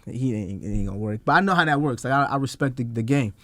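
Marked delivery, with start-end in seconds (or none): 0.1-1.2 dropped low and soft; quiet aside. Retold or imitated. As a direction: say it under your breath. Aside revealing real meaning.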